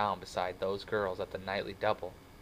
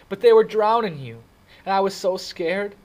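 high, loud, frightened confession; blurted out; 12 semitones higher, 14 dB louder than usual. A narrator's reading. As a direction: cry it out, desperate to explain. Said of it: "he finally blurted out".

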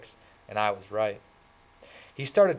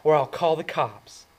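higher and louder, cutting; biting threat; 6 semitones higher, 7 dB louder than usual. A narrator's reading. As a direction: snap out the threat. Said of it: "in a biting voice".

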